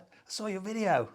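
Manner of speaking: high-pitched Aussie chef; Australian accent. Acted. Chef telling the presenter about his video, high Australian accent. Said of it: "This is my Australian accent".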